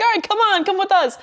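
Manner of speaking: high-pitched, chirpy imitation of a friend; light, upbeat mimicked invitation; breezy friend voice. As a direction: wave them along in a high voice. Voicing friend calling her along.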